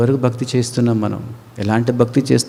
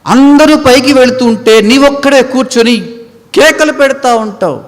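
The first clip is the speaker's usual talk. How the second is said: higher, louder preaching; full-force preaching; 12 semitones higher, 7 dB louder than usual. Acted. Preacher warning the listener left behind, loud preaching. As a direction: hammer it home.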